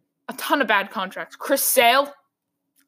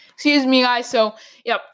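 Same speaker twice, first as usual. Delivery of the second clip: ordinary delivery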